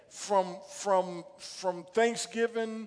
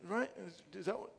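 put-on quiet child question; 12 dB quieter than usual.

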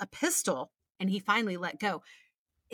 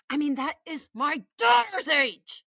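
outraged; 5 semitones higher, 4 dB louder than usual. Real, not acted. Outraged at creep story.

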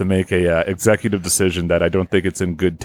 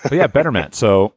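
usual speaking voice

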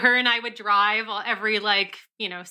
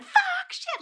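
retold scream. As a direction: squeal the curses as if screaming in pain. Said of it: "And I'm screaming".

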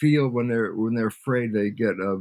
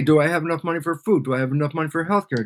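fast string of acted worried questions; hurried, mock-anxious listing; rapid-fire worrying; 2 semitones higher, faster than usual. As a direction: rattle off the worries one on top of another. Acts out constant everyday money worries.

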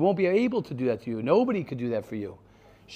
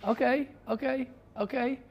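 high dismissive okay; 8 semitones higher, 4 dB quieter than usual. Acted. Mock brushing off the excuse.

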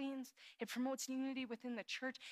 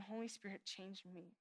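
soft, lowered prayer, almost to oneself; hushed prayer; 3 semitones lower, 10 dB quieter than usual. Acted. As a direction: pray it quietly and humbly. Quoted prayer, dropping to hush.

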